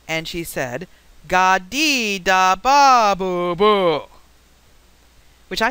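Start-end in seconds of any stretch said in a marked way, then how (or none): 1.3-4.1 imitating a child repeating babble, loud and drawn out; very slow, emphatic, much louder babble; slower and louder. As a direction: spell out each syllable loudly for a slow listener. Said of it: "you say it slower and louder".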